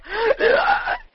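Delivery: sharp, high gasp of disbelief; shocked gasp. Retold or imitated. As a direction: gasp as if the phone just floored you. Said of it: "I was like".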